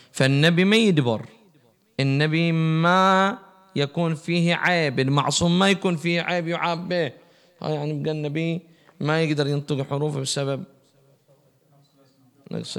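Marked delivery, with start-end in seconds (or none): 0.1-7.1 fervent preaching. Acted. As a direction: drive the point home to the crowd. Preaching at full force. (خطبة حماسية بصوت عالٍ)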